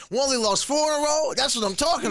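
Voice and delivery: animated retold quote. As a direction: blurt it out as a quoted reaction. Quotes fans' former reaction, raised and fast.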